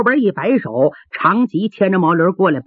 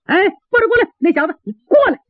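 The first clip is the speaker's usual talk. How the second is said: high-pitched, peremptory summons; calling over; 12 semitones higher, much faster than usual. A narrator's reading. Gate officer summoning soldier who hit the gentleman, calling high. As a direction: call the underling over sharply, pitched high.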